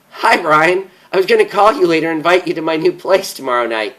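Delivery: giggly and carefree, words tumbling out fast; happy sister laughing on the phone; still laughing. Acted. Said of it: "she said, still laughing".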